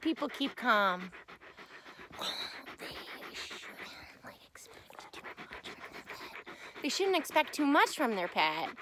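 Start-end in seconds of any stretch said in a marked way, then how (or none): 2.2-6.8 soft whisper as the wise dog. Acted. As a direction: breathe it out as the dog. Voicing the dog.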